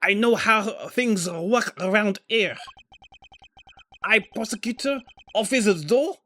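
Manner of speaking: put-on high, indignant French accent; bad French accent. Prosecutor refusing to calm down, bad French accent. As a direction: argue back in a hammed-up French accent. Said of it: "A, a really bad French accent".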